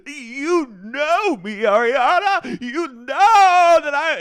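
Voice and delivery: loud, high, drawn-out mock wail; hammed-up pleading, stretched out and shrill with volume; mock overwrought wail. Retelling the cheater pleading with his ex, mock wail.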